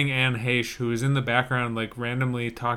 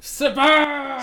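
high, loud gleeful cry; mock hype shout; excited shout; 13 semitones higher, 6 dB louder than usual. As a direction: announce the name like a hype man. Shouting the studio name.

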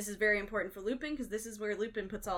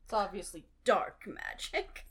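Snape; 3 dB louder than usual. Retold or imitated. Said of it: "Snape goes".

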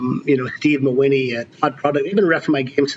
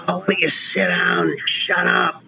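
mocking heckle; 5 semitones higher than usual. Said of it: "makes this comment like".

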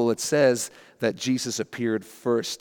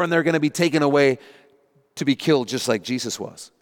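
ordinary delivery

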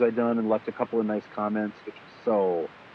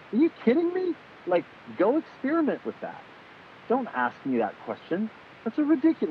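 mock exasperated outburst, much higher; 11 semitones higher than usual. Tim answering the same gear question, hammed-up exasperation. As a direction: play Tim fed up, disbelieving and pitched way up. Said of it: "he's like".

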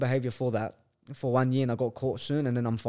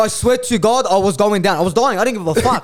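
worked up; 6 semitones higher, 8 dB louder than usual. Retold or imitated. Laughing, heated retelling of refusal.

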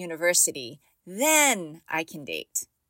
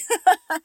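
high laugh; 11 semitones higher, 7 dB louder than usual. Real, not acted. Laugh after urging.

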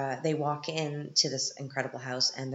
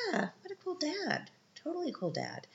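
much higher, mock-thrilled squeal; gushing fan; 14 semitones higher, 3 dB quieter than usual. Viewer gushes about the permissive dad, mock-thrilled. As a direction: swoon over the dad like a fangirl. I'm like.

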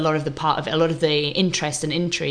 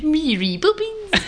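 mock Cockney accent, higher and slow; 12 semitones higher, slower than usual.

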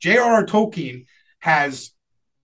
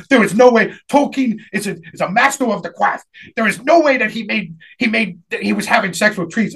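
higher, indignant protest with R and L turned to W; imitated speech impediment; 4 semitones higher than usual. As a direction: mimic a flustered kid with a speech impediment. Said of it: "he talked like this".

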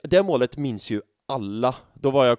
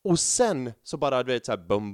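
normal talking voice

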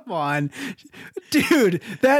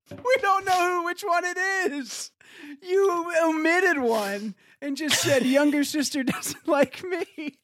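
laughing; 10 semitones higher than usual. Real, not acted. Reading the line back, cracking up.

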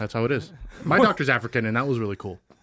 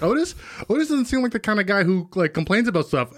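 high, surprised mom voice, much higher pitch; imitating mom; 8 semitones higher than usual. Mom doubting that Otis ever complains, in a high imitating voice. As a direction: play the doubtful mother, pitch way up. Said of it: "And my mom's like".

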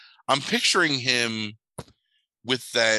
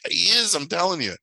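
higher, pushy "he is", not backing down; insistent kid; 8 semitones higher, 3 dB louder than usual. Retold or imitated. Kid insists to his doubtful dad, insistent.

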